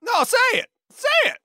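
very high, shrill-pitched urging; goading shout. Acted. Coworker urging another to air grievances, goading shout. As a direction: yell it high, egging them on.

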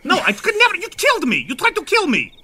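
high, cold accusation; high bitter refusal. Acted. Ivan accuses Stir fry of trying to kill him, high. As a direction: spit back the accusation.

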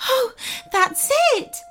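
very high, joyful yelp; overjoyed high-pitched goblin cry. A narrator's reading. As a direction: yelp with sudden relief, voice leaping high. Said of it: "yelped the goblin".

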